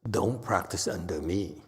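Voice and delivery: ordinary delivery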